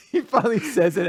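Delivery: normal talking voice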